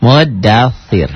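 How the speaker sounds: Muddaththir slowly, dh with tongue tip on the teeth; slow Muddaththir, lisp-like dh. Shown as a demonstration. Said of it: "lidahnya ke ujung gigi".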